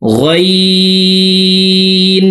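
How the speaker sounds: গইন chanted very slowly and high, from the top of the throat; long rasping গইন; ghain from throat end. Shown as a demonstration. Teacher models ghain for viewers, long chant. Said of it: "গইন হরফের উচ্চারণ হলকের শেষ থেকে".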